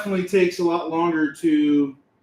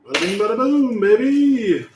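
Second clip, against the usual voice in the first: showy flourish; 4 semitones higher than usual. Acted. Shows off finished craft piece.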